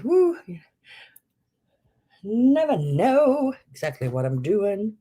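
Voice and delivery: breathless, catching her breath. Real, not acted. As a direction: talk while winded. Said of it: "just gotta catch my breath".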